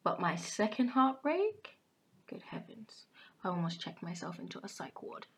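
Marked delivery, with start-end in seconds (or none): 2.3-3.0 breathy whispered good heavens; whispered aside. Real, not acted. Recalling her second heartbreak, she whispers in disbelief.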